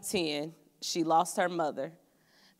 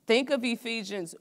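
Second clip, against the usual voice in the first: ordinary talk, nothing marked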